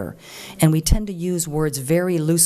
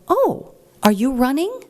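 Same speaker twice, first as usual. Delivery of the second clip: higher, slower, sugary mock-innocent question; sweet fake innocence; 9 semitones higher, 3 dB louder than usual. To the campaign manager, a sugary mock-innocent question. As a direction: play dumb with a sweet smile. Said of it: "Nice smile on my face".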